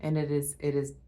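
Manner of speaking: normal talking voice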